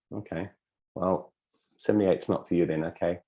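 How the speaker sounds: low, quiet, let-down acceptance; deflated. Acted. Subconscious giving up on idea seventy-eight, deflated. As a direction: act out enthusiasm draining away.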